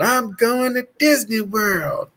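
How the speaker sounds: high, slow, theatrical proclamation; hammy stage actor. Retold or imitated. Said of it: "I started hamming it up".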